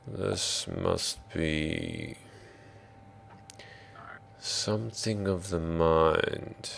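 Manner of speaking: slow, soft voice with long pauses; drow. Acted. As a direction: muse slowly and quietly, weighing each phrase. Game master speaks as the drow.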